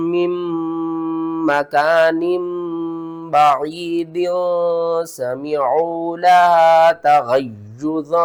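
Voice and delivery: slow, drawn-out chanted verse; Quran recitation. Shown as a demonstration. Teacher reads the verse aloud as a model, slow recitation. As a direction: recite the verse slowly with nasal ghunna. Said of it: "किस तरह?".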